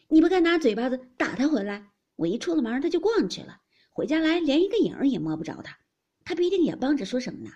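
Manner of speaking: quick loud retort. A narrator's reading. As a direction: cut in sharply to cover for her maid. Fengjie cuts in quickly.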